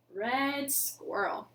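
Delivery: ordinary delivery, nothing marked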